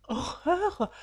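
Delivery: sharp high horrified cry; exclaiming. A narrator's reading. Valentine recoiling from Roger's proposal, horrified cry. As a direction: cry out in horror. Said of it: "s'exclama Valentine".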